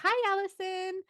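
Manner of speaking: put-on high, cute little kid greeting; small child voice. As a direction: squeak it like a tiny kindergartner. Teacher voices a kid's greeting.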